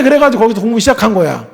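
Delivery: normal talking voice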